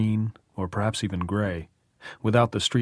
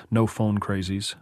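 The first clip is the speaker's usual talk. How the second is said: ordinary delivery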